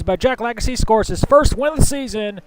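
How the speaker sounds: brisk race-call commentary, rising toward the finish; live play-by-play. Acted. Commentator calling the final laps to viewers, live play-by-play.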